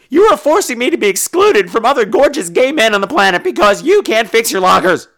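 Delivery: high, loud, outraged tirade; hammed-up angry complaint; indignant rant. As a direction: rail at the gym owner at full volume. Mock rant at gym owner.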